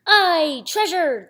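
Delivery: high loud pirate cheer; crew shout. Acted. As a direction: shout it out together. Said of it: "the others shouted".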